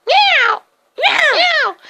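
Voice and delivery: high, loud cat meows; meowing cat doorbell. Acted. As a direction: yowl like a knocked-on cat. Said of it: "knocking and they're like".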